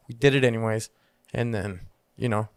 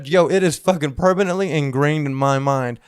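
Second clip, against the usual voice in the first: usual speaking voice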